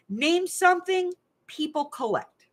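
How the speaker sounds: high and drawn-out game show question; high, slow question. Shown as a demonstration. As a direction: stretch it high like a host. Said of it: "name something that people collect".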